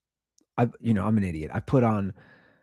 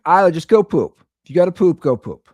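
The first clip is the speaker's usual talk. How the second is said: put-on louder, called out to a child downstairs; dad calling down to toddler to go poop; 5 semitones higher, 7 dB louder than usual. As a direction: yell it down the stairs to your daughter. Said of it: "So I yell down".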